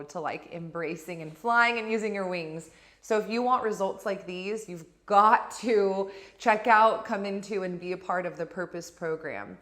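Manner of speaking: upbeat program promo; ad read. Acted. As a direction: sell the program to the listener.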